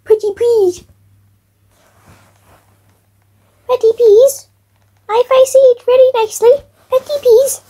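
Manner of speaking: high, sweet pleading little voice; cute begging voice. Acted. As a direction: beg sweetly like a small child.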